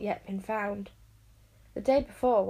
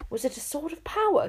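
a higher, startled gasp of a question; lightly gasped alarm of a father; 7 semitones higher than usual. A narrator's reading. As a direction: catch the breath in alarm. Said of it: "gasped the Enchanter".

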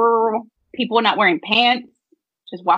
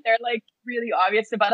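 usual speaking voice

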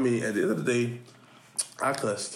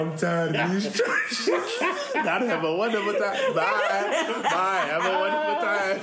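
playful mock voice; 8 semitones higher than usual. Joins the mock church voice.

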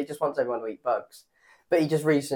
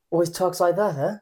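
breathy, higher Mark Wahlberg voice; Mark Wahlberg impression; 6 semitones higher, 3 dB louder than usual. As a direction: sound always out of breath. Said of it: "That's quite a good impression".

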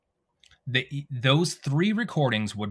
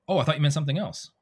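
quick, higher-pitched line read; rushed; 5 semitones higher, much faster than usual. Shown as a demonstration. Actor squeezing a game line into two seconds, rushed. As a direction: rattle it off to fit a tight time slot. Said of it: "Oh, you have two seconds".

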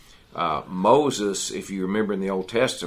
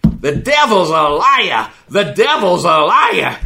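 much louder, much higher, slow shout; shouting preacher cry; 11 semitones higher, 13 dB louder, slower than usual. Retold or imitated. Roommate Andrew rebuking the devil, imitated full-force shout. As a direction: bellow it like a fiery preacher. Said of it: "Andrew stomping his feet".

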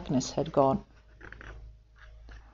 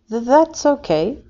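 stammering; 7 semitones higher, 5 dB louder than usual. Acted. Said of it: "stammered little Kiwi".

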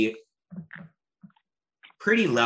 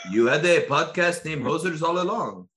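higher, lightly put-on French accent; mock French accent; 5 semitones higher than usual. Prisoners mocking the podcaster in a sketchy, higher French accent. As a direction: try a French accent and half miss it. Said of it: "Is that your French accent?".

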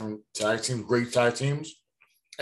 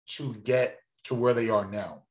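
ordinary delivery

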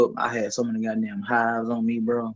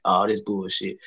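ordinary talk

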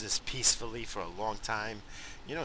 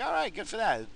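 pleased quip; 12 semitones higher, 4 dB louder than usual. Retold or imitated. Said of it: "I was like".

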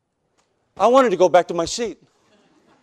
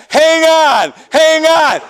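higher, louder hang on; mock urging shout; 7 semitones higher, 3 dB louder, much slower than usual. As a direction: shout at him to hold on. Other worker shouting the opposite.

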